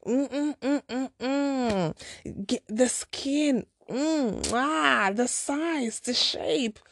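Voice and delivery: lightly put-on God voice, relishing it; God bestowing generously. God handing out blessings to her, playfully savoring. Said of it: "God was just like".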